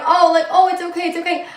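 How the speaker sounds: high, cooing mock comfort; a mocking imitation of women soothing a crier; mock consoling. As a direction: coo it like the whole room soothing her. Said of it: "sitting there like".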